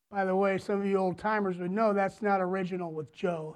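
ordinary talk

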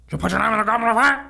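hammed-up furious headmaster, half laughing; angry Dumbledore. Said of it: "not as egregious as".

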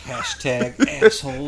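high, slow, drawn-out joking call; playful drawn-out chant; mock hashtag. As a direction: stretch it out like a silly slogan.

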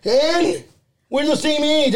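a hint of a pouting little boy; whiny kid. Nephew complains to his aunt, in a lightly whiny kid voice.

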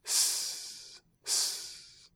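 long voiceless hiss like a snake; soft snake hiss. Shown as a demonstration. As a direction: hiss it out like a serpent. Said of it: "suena como el serpiente".

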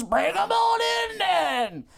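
high, drawn-out hearty bellow; booming boss. Acted. Boss calling Mister Harper in, bellowing. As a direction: holler like a clueless big boss.

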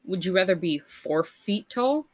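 ordinary talk

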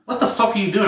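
high-pitched retold disbelief; a high, disbelieving mock yell at the hunter; exasperated outburst. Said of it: "I'm like".

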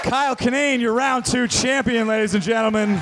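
host announcement. Real, not acted. Host announces round champion.

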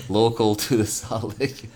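laughing through the words; giggling while protesting, half-joking. Real, not acted. Laughing at accent joke.

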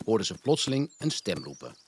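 ordinary delivery, nothing marked

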